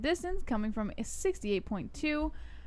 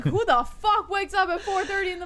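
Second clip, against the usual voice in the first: incredulous outburst; 7 semitones higher, 3 dB louder than usual. Retold or imitated. Retells own reaction to early start.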